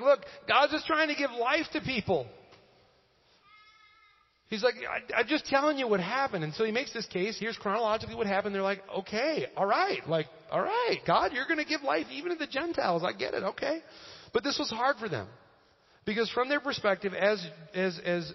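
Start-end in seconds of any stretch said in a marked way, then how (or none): 0.0-2.3 high, emphatic plea; Peter. Acted. Apostle pleading God's cause to critics, emphatic appeal. 4.8-6.4 high, insistent self-defense; Peter. Acted. Apostle answering his critics, insistent defense. 8.9-13.8 higher-pitched, mock giving in; acted concession. Believers conceding life to the Gentiles, acted acceptance. Acts critics conceding to Peter.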